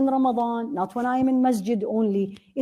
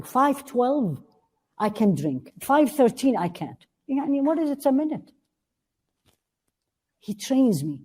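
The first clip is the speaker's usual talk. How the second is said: ordinary delivery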